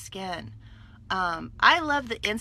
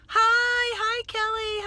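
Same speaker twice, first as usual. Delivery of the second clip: excited greeting; 14 semitones higher, 7 dB louder, much slower than usual. Real, not acted. Greeting live viewers excitedly.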